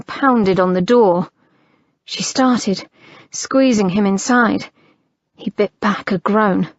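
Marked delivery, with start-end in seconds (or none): none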